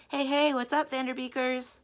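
high, loud friendly call. Acted. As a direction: hail them from behind the counter. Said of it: "Benny called from behind the register".

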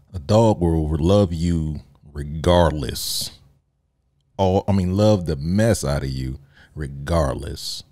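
slow, weighty praise of dogs; drawn-out heartfelt emphasis. Real, not acted. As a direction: stretch each word out to sell it.

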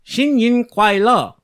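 high, loud, slow Chinese greeting; better attempted Chinese. Shown as a demonstration. Cohost shows his improved greeting, careful attempted Chinese. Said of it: "That was a lot better".

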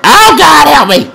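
high, loud panicked cry for help; panicking. Real, not acted. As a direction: wail out in sudden panic. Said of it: "I'm panicking and".

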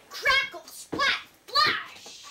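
high, loud, punched sound words; explosive storybook sound effects; dramatic sound effects. Acted. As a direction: burst out each splashy word.

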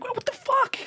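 very high, squeaky disbelief; mock shocked squeak. Telling friends his reaction to the ex, mock shocked squeak.

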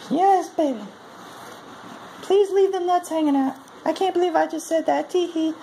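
very high falsetto woman's voice; mock female partner. Man imitating his partner's reply, mock falsetto. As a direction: put on a squeaky falsetto, far above your own.

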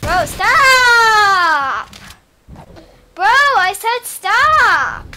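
loud, high furious shout; angry yelling. Real, not acted. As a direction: scream at them to stop. Yelling at friend to stop.

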